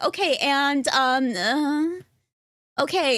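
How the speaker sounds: a hint of a bubbly Valley Girl. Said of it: "a very Valley Girl voice".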